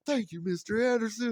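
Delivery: put-on high cheerful child's thank-you; kid thanking. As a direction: chirp it like a happy boy.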